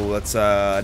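usual speaking voice

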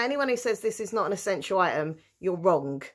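ordinary talk

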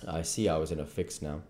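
ordinary delivery, nothing marked

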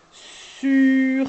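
"Sur" with held s and a long u; stretched word. Shown as a demonstration. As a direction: hold every sound of "Sur". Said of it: "on fait durer la, le son suivant".

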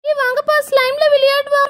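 put-on high-pitched child's invitation; eager little girl. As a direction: call friends over in a high girlish voice. Little girl calls friends to play. (ஆர்வமான சின்னப் பொண்ணு குரல்)

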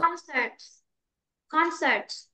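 teacher modelling Concert, first syllable stressed. Shown as a demonstration. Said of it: "nhấn âm một".

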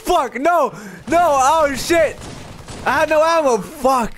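high-pitched dismayed outburst; real frustration. Real, not acted. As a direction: cry out in gamer frustration. Dies in game without ammo.

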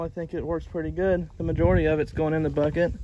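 ordinary talk, nothing marked

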